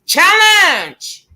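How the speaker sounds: high, loud, long-held announcing cry; James. Shown as a demonstration. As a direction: belt it out, stretched and falling at the end. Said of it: "my James voice".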